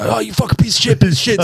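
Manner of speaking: high mock yell at the broken car; angry brothers. Retelling the brothers swearing at their car, hammed-up yell. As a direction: ham up the brothers cursing the car. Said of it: "all the boys go through and go".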